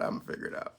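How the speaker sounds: soft unvoiced whisper. Real, not acted. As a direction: breathe it as a whisper. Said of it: "You should do A S M R".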